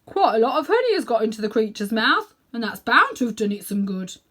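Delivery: hammed-up warthog. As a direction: boom it like a know-it-all warthog. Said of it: "said the warthog".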